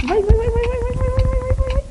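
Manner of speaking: high, rapid urging; excited urging. Real, not acted. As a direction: cheer it on frantically. Urging during fight.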